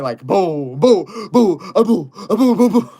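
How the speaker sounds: loud, high boo-boo popping sounds; muscle popping noises. Pretend. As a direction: pop out the muscles with loud boos.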